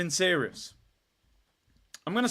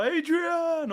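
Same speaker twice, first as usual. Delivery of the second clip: Rocky calling Adrian; 11 semitones higher than usual. Retold or imitated. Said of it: "He went to Russia".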